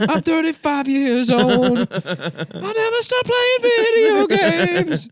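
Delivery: mocking loser voice. Mocking a thirty-five year old Pokemon fan, whiny. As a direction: whine it like a sad grown gamer.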